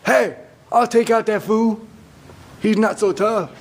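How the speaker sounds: high, cocky little-guy voice; David's voice. Acted. Little David to Saul offering to fight, high cocky voice. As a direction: pipsqueak bragging with bravado. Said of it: "and he says to Saul".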